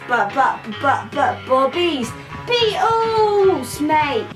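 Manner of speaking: usual speaking voice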